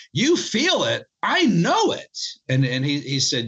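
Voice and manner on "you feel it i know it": put-on a light New York Italian accent, pitched higher and climbing. Bella Dodd answers Dietrich in New York Italian accent. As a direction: impersonate Bella's New York Italian lilt, rising on I know it. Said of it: "in her kind of New York Italian accent".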